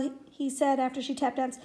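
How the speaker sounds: plain reading voice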